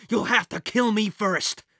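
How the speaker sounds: screamed defiance. Acted. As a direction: defy the villain at full voice. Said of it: "she screamed".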